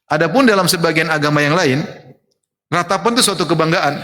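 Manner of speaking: normal talking voice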